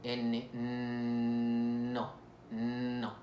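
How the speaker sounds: no with a long humming N before the vowel; "no", opened on a sustained N; held N. Shown as a demonstration. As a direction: stretch the N in no. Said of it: "Y luego tenemos la N".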